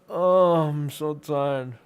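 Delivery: usual speaking voice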